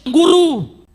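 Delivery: memanggil tinggi dan keras; seruan. Retold or imitated. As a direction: berseru memanggil Guru dengan cemas. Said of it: "berseru kepada Yesus". (seruan murid yang ketakutan)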